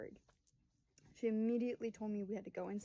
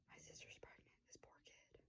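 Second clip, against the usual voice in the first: very soft, voiceless aside; hushed whispered dismay; imitated whisper; 22 dB quieter than usual. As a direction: whisper it as the worried sister's private thought. Acts sister's other, worried reaction.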